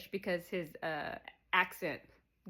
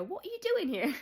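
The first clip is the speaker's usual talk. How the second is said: much higher, sharper demand; caught-out snap; 11 semitones higher, 4 dB louder than usual. Retold or imitated. Matt catching Cooper at the door, high and sharp. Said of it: "he's like".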